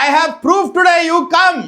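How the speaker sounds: high, loud triumphant demand; shouting. Retold or imitated. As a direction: shout it through to the house. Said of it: "Like that she is shouting".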